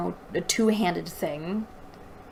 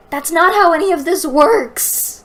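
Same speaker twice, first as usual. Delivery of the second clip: much higher, louder, fed up; exasperated outburst; 9 semitones higher, 10 dB louder than usual. Real, not acted. Reader ranting at the fight scene, exasperated shout. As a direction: throw your hands up and shout it.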